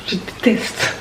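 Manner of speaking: quick unvoiced whisper under her breath; whispered aside. Real, not acted. Vlogger to herself about the noise, whispered. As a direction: breathe it out as a private grumble.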